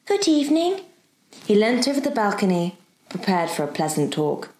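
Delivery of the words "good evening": high, cheerful greeting. A narrator's reading. Girl greeting the professor, cheerful. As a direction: call it out in a cheerful high lilt. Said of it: "when he heard her cheerful".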